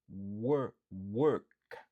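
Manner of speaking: a loud drill of Work, not walk. Shown as a demonstration. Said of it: "Se pa walk, se work".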